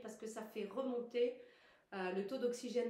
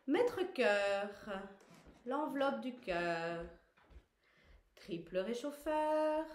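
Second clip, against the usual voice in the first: slow, raised, spaced-out cueing; exercise call-outs; 4 semitones higher, 4 dB louder, much slower than usual. Real, not acted. Teacher naming meridians finger by finger, slow projected call-outs. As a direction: call each point high and loud, drawn out.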